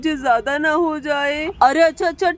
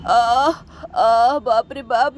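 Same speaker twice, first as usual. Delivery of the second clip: lower, slow groan of pain; 4 semitones lower, slower than usual. Acted. Father groaning while his wife checks the sore. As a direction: let out a drawn-out ache.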